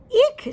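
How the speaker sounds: high, loud squeal of surprise; a sharp startled shriek; startled eek. Acted. Goldilocks hits hard bed.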